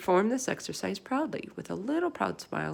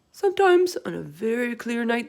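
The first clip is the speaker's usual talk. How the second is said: giant voice; 5 semitones higher, 9 dB louder than usual. Acted.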